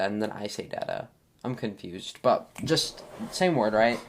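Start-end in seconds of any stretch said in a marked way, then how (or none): 0.6-1.1 "data" as DAT-uh, short first a; softer "data" with first syllable like "dad"; switched short-a pronunciation. Shown as a demonstration. Podcaster admitting they copy others, "data" said DAT-uh. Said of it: "until I hear someone say data".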